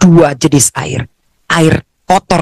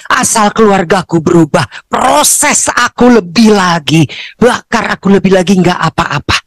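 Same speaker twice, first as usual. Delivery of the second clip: usual speaking voice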